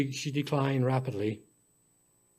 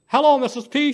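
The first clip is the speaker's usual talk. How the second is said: put-on high, louder child hello; little girl greeting; 9 semitones higher, 6 dB louder than usual. A narrator's reading. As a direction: greet her like an eager child. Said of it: "she said".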